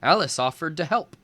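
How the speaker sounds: ordinary delivery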